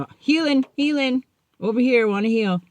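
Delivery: high, hurried eager volunteering; playful keen reply. Retold or imitated. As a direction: wave your hand, pick me. Eager answer to sponsor.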